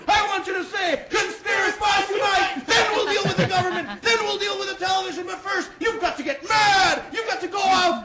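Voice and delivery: full-voice, high-pitched mock tirade; yelled rant. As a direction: shout to rouse listeners to their windows. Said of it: "yelled it like that".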